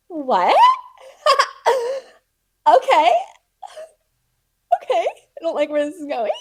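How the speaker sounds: high, loud panicked reaction with nervous laughter; hammed-up freak-out. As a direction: act out losing it, voice leaping up. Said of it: "I kept literally twitching and freaking out".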